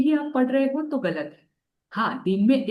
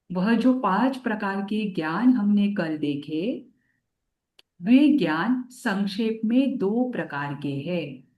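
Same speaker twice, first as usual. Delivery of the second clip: ordinary talk, nothing marked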